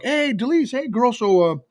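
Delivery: high-pitched, casual greeting as if all is fine; pretending nothing happened. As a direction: act breezily like there was no fight. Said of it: "pretend like nothing happened".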